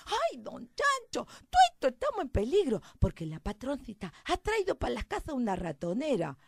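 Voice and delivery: high, loud country mouse voice; alarmed little mouse. Acted. Mouse tells the hen about the mousetrap, alarmed.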